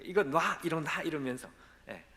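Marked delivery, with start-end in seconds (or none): 0.0-1.0 pushing away. Retold or imitated. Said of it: "막 뿌리치기 시작했대요".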